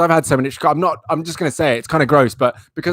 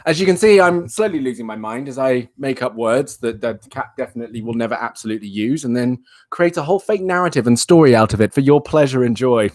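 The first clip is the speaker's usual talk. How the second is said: usual speaking voice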